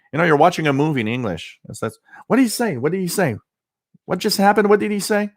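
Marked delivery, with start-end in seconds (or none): 2.3-5.3 high, mock puzzled friend pestering; imitating a baffled friend, higher; friends asking. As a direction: pitch it up like a confused friend nudging you. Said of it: "coming up to me and saying".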